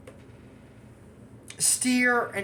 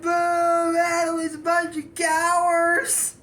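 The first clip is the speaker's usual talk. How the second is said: loud, high booing taunt; loud high jeering crowd; 11 semitones higher, 4 dB louder, slower than usual. Retold or imitated. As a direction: boo them like the whole crowd. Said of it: "Everybody's like".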